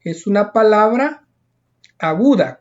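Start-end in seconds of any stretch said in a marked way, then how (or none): none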